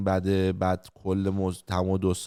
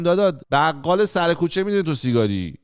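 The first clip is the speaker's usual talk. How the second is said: exasperated retort; 6 semitones higher, 4 dB louder than usual. Retold or imitated. Retort to friend hiding smoking.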